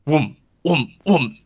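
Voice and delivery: three spaced whooms; short, swooping, falling whooms; imitated coffin noise. Tyler hears the coffin while reaching for his wallet, whooming noise. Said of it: "he hears it".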